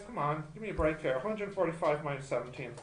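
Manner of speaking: ordinary talk, nothing marked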